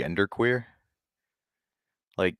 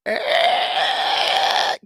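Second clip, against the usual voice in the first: feeble grunt; 11 semitones higher, 3 dB louder than usual. Retold or imitated. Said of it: "my daddy said".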